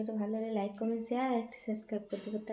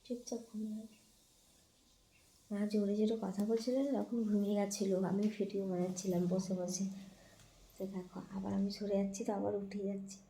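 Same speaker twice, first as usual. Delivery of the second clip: much softer, hushed narration; much softer hushed aside; 6 dB quieter than usual. Real, not acted. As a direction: keep quiet so the cat sleeps. Whispering so cat sleeps.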